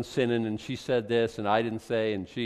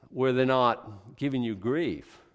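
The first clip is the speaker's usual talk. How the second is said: ordinary delivery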